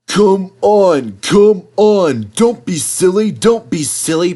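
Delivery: high, loud chanting puffs; puffing Henry. Acted. Henry urging the slipping vans on icy rails, high chant. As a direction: coax it in the rhythm of a straining engine. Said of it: "puffed Henry into the vans".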